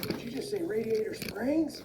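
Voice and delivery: very high, slow cartoon question; astonished character voice. Truck hears his hometown named, high slow astonished voice. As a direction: stretch it out high in disbelief. Mater reacts to old car.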